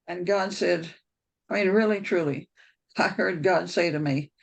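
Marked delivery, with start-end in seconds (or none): none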